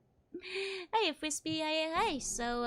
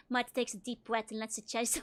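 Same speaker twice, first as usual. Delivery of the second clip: usual speaking voice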